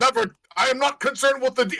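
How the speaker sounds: loud, very high hammed-up captain's cry; over-the-top heroic outburst; defiant shout. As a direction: shout down the droid mid-crisis. Captain cuts off the droid's odds.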